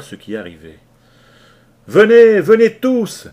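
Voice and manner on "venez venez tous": very loud, high rallying cry; shouting. Acted. Leach summoning the sailors to join in, shouting. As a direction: call everyone into the fight. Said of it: "cria Leach".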